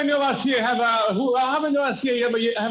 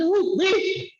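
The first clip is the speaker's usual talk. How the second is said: startled who me; 8 semitones higher than usual. Retold or imitated. Preacher answering the officer, high surprised squeak. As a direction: pitch jumps much higher in surprise.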